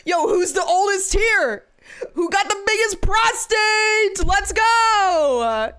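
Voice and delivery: loud, high hype-man shouting; rally the crowd at full voice, stretching the last words; mock hype. Mock hype call-out to chat.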